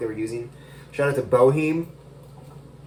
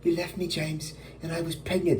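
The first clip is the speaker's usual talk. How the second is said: higher-pitched acted reproach in own accent; higher, dramatized accusation without the British accent; 6 semitones higher, 5 dB quieter than usual. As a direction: play a wronged lover confronting him. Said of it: "I don't know why I made her British".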